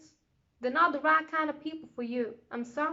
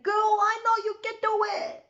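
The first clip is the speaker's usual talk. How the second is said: hyped friend; 8 semitones higher, 6 dB louder than usual. Retold or imitated. Voicing a hyped supportive friend.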